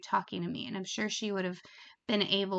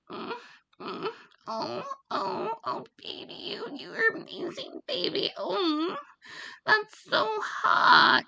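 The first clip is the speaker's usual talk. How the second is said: mock over-the-top moaning, much higher; crazy moans; 13 semitones higher, 4 dB louder than usual. Shown as a demonstration. Mocking an annoying phone sex worker, hammed-up high moans. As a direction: ham up breathy fake ecstasy, squealing and dragged out. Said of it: "not crazy moans like".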